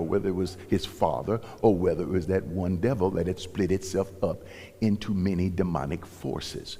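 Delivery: ordinary talk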